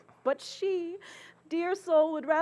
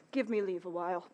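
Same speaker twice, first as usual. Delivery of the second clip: ordinary talk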